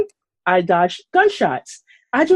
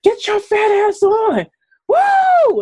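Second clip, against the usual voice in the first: friend yelling; 13 semitones higher, slower than usual. Retold or imitated. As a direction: bellow it like a pushy friend on a bike. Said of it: "he's yelling at me the entire time".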